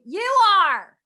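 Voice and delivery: loud, high mock shout; retold call-out. Chris Carter answering her from the monitor room, loud high call. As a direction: call it back from another room.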